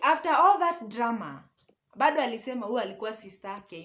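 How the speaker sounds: usual speaking voice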